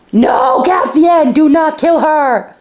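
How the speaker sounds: loud desperate plea; begging the character not to kill; pleading. Real, not acted. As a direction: cry out to stop him.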